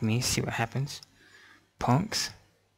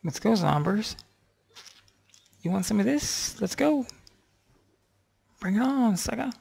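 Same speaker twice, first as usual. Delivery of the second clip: taunting; 10 semitones higher than usual. Real, not acted. Taunting mobs.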